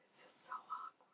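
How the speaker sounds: hushed cringing whisper. Real, not acted. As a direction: murmur it under your breath, embarrassed. Cringing at scene.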